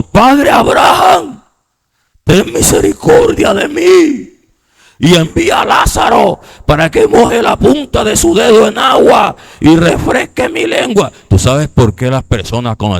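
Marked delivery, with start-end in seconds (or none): 0.0-11.1 high, strained, desperate plea; crying out. Acted. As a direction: beg for mercy at a pained high pitch. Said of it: "dando voces, dijo". (clamando a gritos, voz aguda)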